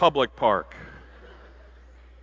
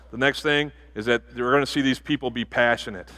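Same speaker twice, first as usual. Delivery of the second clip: ordinary talk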